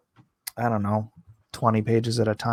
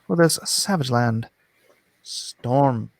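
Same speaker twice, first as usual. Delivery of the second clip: ordinary talk, nothing marked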